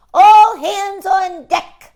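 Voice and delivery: high, slow pirate bellow; a hammed-up captain's bellow. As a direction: boom out the order to the crew. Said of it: "bellowed Earl".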